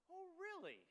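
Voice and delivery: high, incredulous "Oh, really?"; retold surprise, lifted high; surprised. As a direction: pitch leaps up in disbelief. Surprised reply to good news.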